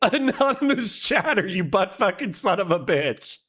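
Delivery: high-pitched swearing through laughter; laughing mock curse. Real, not acted. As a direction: curse them affectionately while cracking up. Playfully cursing sender while laughing.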